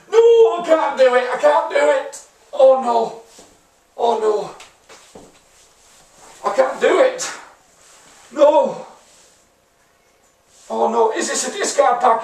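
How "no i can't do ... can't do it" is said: high, loud pleading cry; distraught wail. Real, not acted. Gamer distraught over his discard mistake. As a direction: beg the universe it isn't true.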